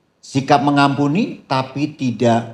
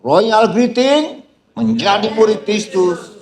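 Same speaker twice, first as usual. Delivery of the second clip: slow, high and loud call of greeting; stretched-out rallying greeting; loud royal greeting; 8 semitones higher, 3 dB louder, slower than usual. Shown as a demonstration. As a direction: call it out like a herald to the congregation. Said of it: "Biar lebih kencang lagi".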